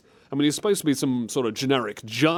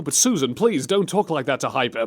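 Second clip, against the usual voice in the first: ordinary delivery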